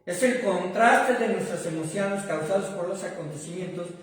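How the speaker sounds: preaching at full force. Acted. Pastor to congregation, preaching at full force. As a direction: pastor preaching loudly to his congregation about joy.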